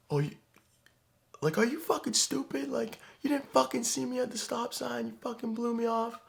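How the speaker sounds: very high, hammed-up furious rant; mock angry yelling. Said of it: "he was yelling at me like".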